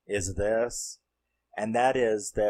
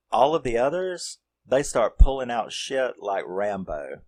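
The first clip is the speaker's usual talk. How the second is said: ordinary delivery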